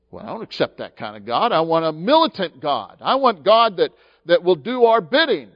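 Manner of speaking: building from quick demand to loud insistence; demanding crowd. Retold or imitated. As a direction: voice the crowd's rising demand for a militant God. People demanding militant God.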